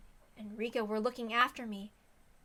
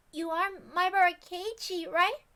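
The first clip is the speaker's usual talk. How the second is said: put-on much higher, gentle worried girl voice; worried girl Rena; 8 semitones higher, 6 dB louder than usual. Rena checking on Keiichi after he collapsed, worried.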